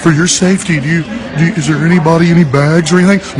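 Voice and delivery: parodying a polite security announcer, fast at first; sneering imitation of a public address announcer; mock announcement voice. Mocking an airport security announcement.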